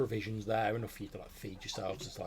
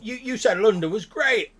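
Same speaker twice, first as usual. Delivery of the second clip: high, loud little goblin; indignant goblin squeak; 12 semitones higher, 12 dB louder than usual. Acted. Goblin Smudge complaining to the player, high and loud.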